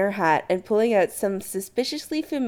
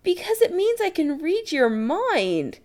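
high, slow, stretched disbelief; disbelieving; 11 semitones higher than usual. Acted. Chris to Adam about the blocking charm, disbelieving. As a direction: spell it out in disbelief. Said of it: "Chris says disbelievingly".

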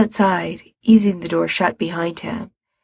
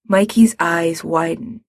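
ordinary delivery, nothing marked

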